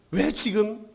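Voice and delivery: ordinary talk, nothing marked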